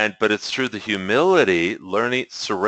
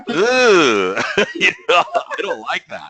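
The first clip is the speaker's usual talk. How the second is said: high, laughing ugh of dislike; laughing groan of distaste; 12 semitones higher, 4 dB louder, slower than usual. Retold or imitated. To his guide, recoiling with a laughing groan. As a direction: groan it out through laughter, pulling a face. Mock disgust at the answer.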